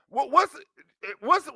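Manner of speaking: ordinary delivery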